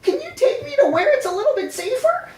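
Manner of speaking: cheerful Hamtaro. Acted. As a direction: chirp it sweetly to the giant worm. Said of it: "Hamtaro cheerfully exclaims".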